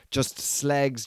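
ordinary talk, nothing marked

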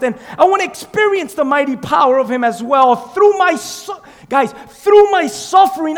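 high, loud, driving sermon delivery; full-force preaching. Acted. Preacher urging the church to welcome suffering, full-force preaching. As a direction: preach it at a shout.

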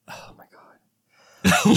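slow, whispered dismay at oneself; exasperated whisper. Real, not acted. Frustrated at saying like too often, exasperated whisper. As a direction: whisper it, drawn out.

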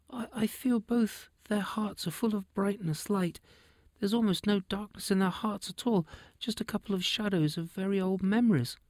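put-on high, soft little girl voice; a small innocent foal, wondering; young unicorn child. Young unicorn Harp speaks.

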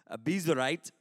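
Abiezrite said with a playful put-on accent; fancy accent on Abiezrite. Shown as a demonstration. Pastor savoring the name Abiezrite for the congregation, mock accent. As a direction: roll out Abiezrite with a little flourish. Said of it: "I threw in that little accent for that time".

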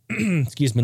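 ordinary talk, nothing marked

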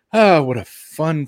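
ordinary talk, nothing marked

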